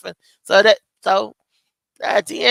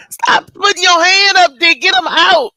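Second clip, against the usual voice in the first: woman in labor shouting laughing; mock desperate yell; 7 semitones higher than usual. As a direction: yell at the doctor to hurry. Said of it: "And I was like".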